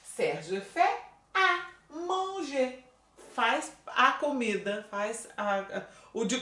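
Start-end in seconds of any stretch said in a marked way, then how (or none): 0.0-2.8 fait stressed, slow and clear; teacher modelling slowly; slow model sentence. Shown as a demonstration. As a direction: spell out fait for the class. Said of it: "vira é".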